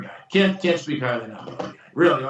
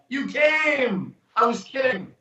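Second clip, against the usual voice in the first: higher, shouted mock surprise; retold ad-lib, higher and shouted; 8 semitones higher, slower than usual. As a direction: shout the joke at the guests.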